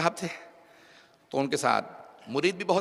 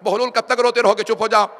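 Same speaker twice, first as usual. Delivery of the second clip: loud caliph voice; 5 semitones higher, 8 dB louder than usual. Acted. Caliph tells weeping Bahlul stop.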